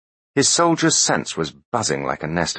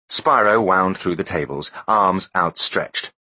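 usual speaking voice

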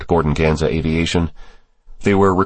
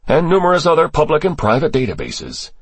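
higher-pitched character voice for testimony; briefing official; 8 semitones higher than usual. Acted. Official briefing senators on terrorist ties, higher character voice. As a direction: lay out the intelligence in a raised register.

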